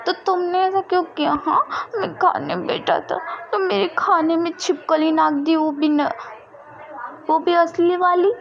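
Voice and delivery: put-on high, outraged child voice; angry little boy. A narrator's reading. Vam ji accusing Wing over the lizard, high outraged kid voice. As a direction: protest like a wronged little kid.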